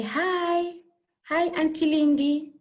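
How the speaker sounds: high, sing-out friendly greeting; pupil's greeting. Pretend. Pupil greets the teacher, high cheerful greeting. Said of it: "Maybe if you say".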